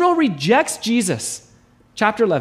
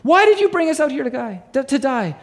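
higher, whiny wail at God; mock whining; 6 semitones higher than usual. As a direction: play the grumbling crowd, pitched up high. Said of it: "they start whining against God".

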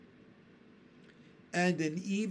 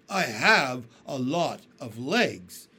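slow, louder boastful centipede; proudly; 8 dB louder, slower than usual. A narrator's reading. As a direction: show off each word. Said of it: "the centipede answered proudly".

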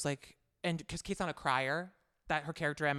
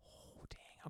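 whispered oh dang; 25 dB quieter than usual. Real, not acted. Put on the spot for ranking.

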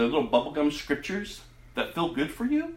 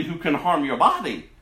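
usual speaking voice

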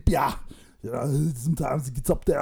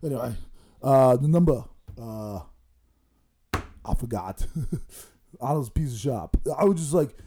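ordinary delivery

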